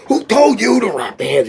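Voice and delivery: high mock yell; hammed-up angry question; imitating Stallone yelling. Stallone yelling at the trainer on set, high mock yell. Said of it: "Stallone starts screaming".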